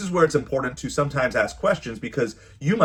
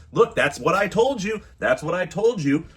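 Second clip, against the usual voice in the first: mock smug parent, pitched higher; a gloating parent rubbing it in, lightly imitated; smug told-you-so; 6 semitones higher than usual. Parent mocking a kid who finally listened, smug and higher.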